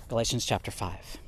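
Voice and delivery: ordinary delivery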